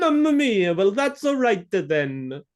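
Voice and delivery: over-the-top fake Italian accent, slowed on the last words; stupid American fake Italian. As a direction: ham up a bad Italian accent like a clueless American. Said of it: "my answer is".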